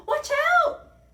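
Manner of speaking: very high, loud warning; alarmed cry. A narrator's reading. Tiny warning friends of the crashing foot, high yell. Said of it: "cried Tiny".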